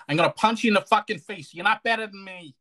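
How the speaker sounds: put-on fighting talk; mock threat. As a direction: playfully threaten a punch. Mock threat demonstrating fired-up accent.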